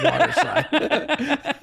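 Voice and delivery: slow, breaking into a real laugh; self-mocking laugh. Real, not acted. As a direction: laugh at yourself mid-line.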